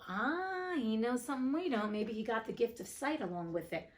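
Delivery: plain reading voice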